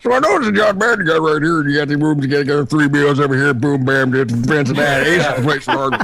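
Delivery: put-on excitable commentator voice; John Madden. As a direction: ramble like a football commentator. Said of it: "doing his version of John Madden".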